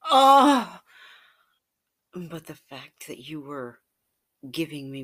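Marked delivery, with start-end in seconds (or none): 0.1-0.8 high, very loud cry of orgasm; climax cry. Acted. Climax during the story.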